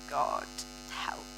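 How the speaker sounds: quiet plea. Retold or imitated. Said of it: "my heart was saying".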